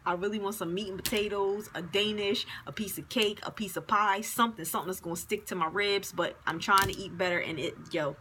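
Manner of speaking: normal talking voice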